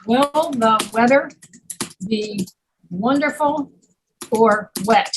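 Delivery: word by word, every W clear in "Will the weather"; clear W sounds. Shown as a demonstration. Teacher models W sounds for student, word by word. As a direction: pause between words, sounding the W in "wonderful or wet". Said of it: "there's all your W sounds".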